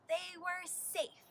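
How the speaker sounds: plain reading voice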